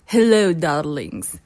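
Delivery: low, drawled Eastern European diva; campy drag queen. Acted. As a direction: purr it like a grand foreign diva. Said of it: "First, we have Irina Akwskaya".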